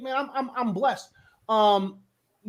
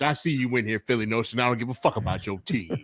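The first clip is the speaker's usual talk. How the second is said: smooth host voice; 8 semitones lower than usual. Acted. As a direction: lay it on low and smooth like a radio host. Acts smooth radio host voice.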